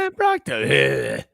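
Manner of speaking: drawn-out low growl; slow deep mock voice.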